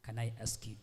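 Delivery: usual speaking voice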